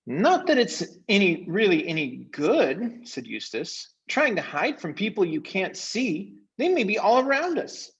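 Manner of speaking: very high, know-it-all voice; smug Eustace. Acted. Eustace dismissing Caspian's magic, very high and smug. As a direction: scoff at the idea of magic.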